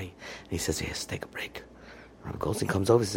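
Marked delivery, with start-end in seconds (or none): 0.8-1.6 hushed, lower voice; very soft, subdued imitation; tearful. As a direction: barely get it out, retelling a tearful moment. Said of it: "he begins to cry".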